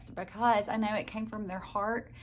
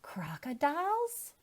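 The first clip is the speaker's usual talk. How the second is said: breathless whispered, slow, rising question; whispered wonder; 9 semitones higher than usual. Acted. Girl hears about crocodiles, whispered amazement. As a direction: hush it in awe.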